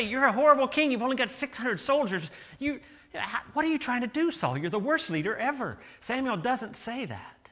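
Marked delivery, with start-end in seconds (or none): 0.0-5.8 loud, high, berating rebuke; harsh scolding. Pretend. Pastor mocks a harsh prophet, loud angry scolding. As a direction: tear into him like a furious prophet. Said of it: "Samuel didn't say".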